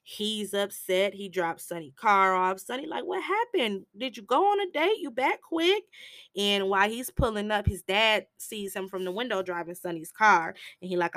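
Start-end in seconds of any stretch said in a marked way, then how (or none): 3.0-5.8 pitched up, quick; teasing friend asking; high curious questions. Retold or imitated. Sonny's questions voiced.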